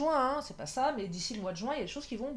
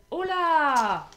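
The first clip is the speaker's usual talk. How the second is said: surprised; 6 semitones higher, 9 dB louder than usual. Real, not acted. Surprise at the drawn cards.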